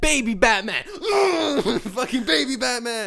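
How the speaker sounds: high, loud overwhelmed fan cry; hammed-up high-pitched elation; way too excited. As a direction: gush like an overexcited fan. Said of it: "way too excited for that".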